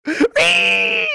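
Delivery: very high shrill piggy squeal; mocking squeal. Mocking critics online, a high piggy squeal. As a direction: squeal like a pig to mock them. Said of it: "squealing like piggies".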